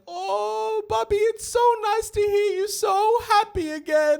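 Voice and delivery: very high, loud, slow doting mother; exaggerated impression of a delighted mum; gushing mum. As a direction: coo piercingly high at your child. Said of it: "my mum's like".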